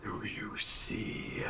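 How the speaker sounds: slow, drawn-out ghostly whisper; spectral whisper. Acted. Spectre prompting its victim to look, slow ghostly whisper. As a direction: whisper it slowly like a phantom.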